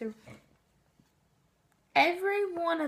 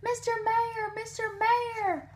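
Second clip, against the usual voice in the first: calling out; 7 semitones higher than usual. A narrator's reading. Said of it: "Horton called".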